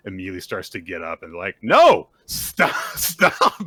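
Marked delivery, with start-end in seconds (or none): 1.6-3.6 high, loud retold "stop" through laughter; alarmed mock shout. Classmates stopping a sick boy getting up, laughing mock shout. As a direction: yell it in mock alarm, cracking up.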